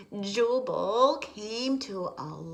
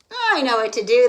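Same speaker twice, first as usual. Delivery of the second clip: captain; 2 semitones higher, 11 dB louder, faster than usual. Acted. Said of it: "the captain said".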